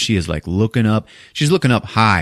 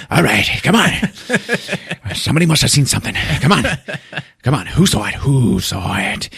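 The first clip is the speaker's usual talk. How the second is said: rushed, demanding, slows on the end; lightly hammed-up frantic cop; 5 semitones higher, faster than usual. Cop pointing his gun at the club crowd, frantic. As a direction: bark at the crowd then drag the last question. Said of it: "he's like".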